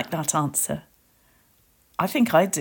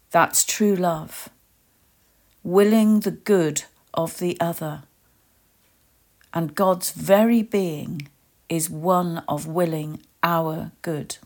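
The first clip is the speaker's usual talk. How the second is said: usual speaking voice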